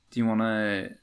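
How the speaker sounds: normal talking voice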